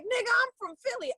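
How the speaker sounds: high-pitched, quick character quote; Kelly. Retold or imitated. Retelling the end scene with the fake accent dropped, high mock protest. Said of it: "the part at the end when she was like".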